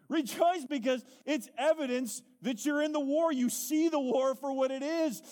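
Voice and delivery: high, loud, ringing exhortation; fervent preaching. Real, not acted. Preacher telling struggling believers to rejoice, loud and fervent. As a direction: preach it at full force, lifting them up.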